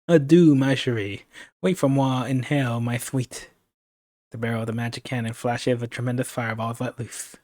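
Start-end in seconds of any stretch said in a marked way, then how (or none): 0.1-3.5 put-on slow, French-accented farewell flourish; pompous French accent. Villain firing the cannon at his captive, pompous French accent. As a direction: open big on the adieu in a French accent, then ease off. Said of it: "that pompous accent of his".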